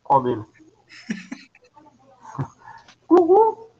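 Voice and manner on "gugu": high, loud shouted call; excited shout. Pretend. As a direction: call it out like a TV crowd. Shouting the TV host's name.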